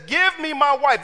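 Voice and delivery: punchy insistent demand. Retold or imitated. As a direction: bark it at the father-in-law. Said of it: "This is the Bible".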